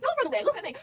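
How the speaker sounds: high, loud mock telling-off; scolding mum. Mum scolding a kid for running off, high and loud. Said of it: "scolding like a mum would scold a kid".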